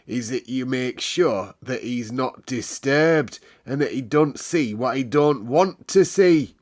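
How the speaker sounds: a hint of a strict old servant, slow; lightly voiced stern manservant. A narrator's reading. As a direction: lay down the rules, slowly. Mr Pitcher lecturing.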